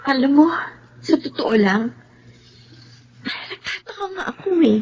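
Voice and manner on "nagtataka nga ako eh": high, soft, on the edge of crying; tearful puzzlement. Acted. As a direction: let the confusion break into tears. Puzzled woman near tears.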